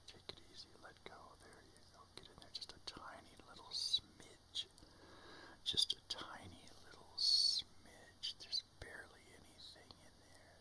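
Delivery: soft coaching whisper. Real, not acted. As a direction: whisper gentle instructions. Gently coaching a bong hit.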